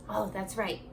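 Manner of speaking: ordinary delivery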